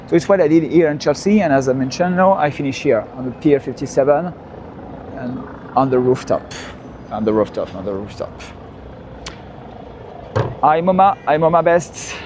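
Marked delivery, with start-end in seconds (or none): none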